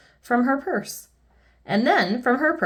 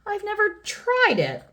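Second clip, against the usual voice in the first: high, plaintive mock whine; sniffling complaint; 13 semitones higher than usual. A narrator's reading. King confessing to Miss Sunshine, high tearful whine. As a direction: pitch it way up, pitifully. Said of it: "sniffed the king".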